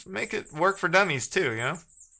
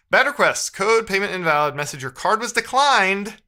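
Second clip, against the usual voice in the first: excited reading; 5 semitones higher, 5 dB louder than usual. Real, not acted. Reading error aloud excited.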